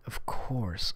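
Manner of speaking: whispered under the breath; just breathe the words. Shown as a demonstration. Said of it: "repeated louder".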